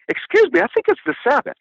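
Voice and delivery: high, fussy mock Pharisee; high, fussy Pharisees objecting to a healing; prissy objection. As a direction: play the pedant scolding Jesus. Pharisees quoted, mocking pedantry.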